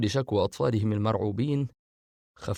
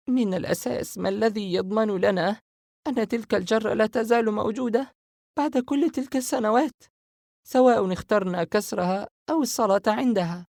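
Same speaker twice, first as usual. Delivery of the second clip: higher female voice, lightly louder, puzzled; confused Sara; 12 semitones higher, 3 dB louder than usual. Acted. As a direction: Sara voicing her doubts to her brothers. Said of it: "سارة وهي في حيرة شديدة".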